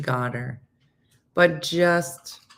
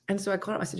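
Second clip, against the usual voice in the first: ordinary talk, nothing marked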